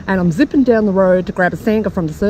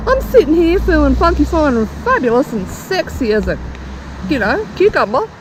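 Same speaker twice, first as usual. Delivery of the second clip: indignant inner voice; 7 semitones higher than usual. Retold or imitated. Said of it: "I'm thinking".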